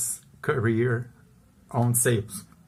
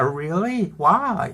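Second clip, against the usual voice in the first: higher, incredulous question; disbelief; 6 semitones higher, much slower than usual. Retold or imitated. A salesman incredulous at the concierge's answer. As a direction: ask in stunned disbelief.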